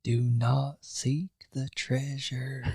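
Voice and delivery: very slow, hushed warning; slow, soft mock-eerie film quote; ominous movie warning. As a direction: draw it out like a solemn omen. Quoting a film's eerie warning.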